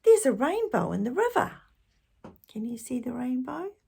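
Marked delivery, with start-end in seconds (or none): 0.0-1.6 higher, louder delighted news; excited discovery. A narrator's reading. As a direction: share a wonderful find. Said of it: "he called to Annie and Tom".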